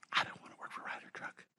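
whispered under the breath; hushed private confession; secret inner thought. Retold or imitated. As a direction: whisper it like a thought you can't say aloud. Inner thought during job interview.

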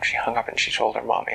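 ordinary delivery, nothing marked

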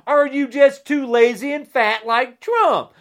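shouted, angry taunt; loud jab. Real, not acted. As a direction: throw the insult loudly. Angry rant repeated then muttered.

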